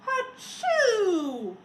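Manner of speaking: loud high acted sneeze; teacher acting a snowman's giant sneeze; big sneeze. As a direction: burst into a huge fake sneeze.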